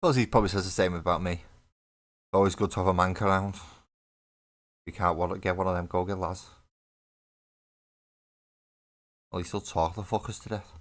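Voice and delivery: put-on a light, jokey stab at a Liverpool accent, fast at first; lightly put-on Liverpool accent, ribbing a friend; bad Liverpool accent. Teasing a stream friend in a weak Liverpool accent. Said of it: "worst Liverpudlian accent anyone's ever heard".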